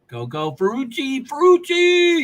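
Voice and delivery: high, loud cheer for Ferrucci; rooting for the driver, up and loud; excited cheer. Real, not acted. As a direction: cheer him on, pitch jumping up.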